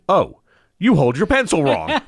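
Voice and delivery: high, loud realization; imitating an astonished surgeon; mock indignant discovery.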